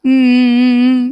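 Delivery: put-on sniffly whimpering; mock crying hums; fake whimper. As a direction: whimper like a wife faking tears for sympathy. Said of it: "நீ அழுகாத செல்லம்".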